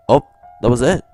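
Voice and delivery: ordinary talk, nothing marked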